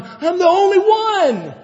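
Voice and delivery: high, loud, slow wail of loneliness; despairing lament. Retold or imitated. Elijah in the cave complaining to God, high and despairing. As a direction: cry it out like the last man standing. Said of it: "He's going".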